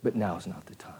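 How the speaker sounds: unvoiced whisper, low and resigned; resigned whisper of acceptance. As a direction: whisper it as if to yourself. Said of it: "John sitting there and going".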